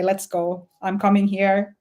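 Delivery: ordinary delivery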